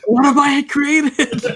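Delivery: high, slow, laughing mad scientist; mad creator. Pretend. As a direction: cackle it like a mad inventor. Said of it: "I picture you hanging up".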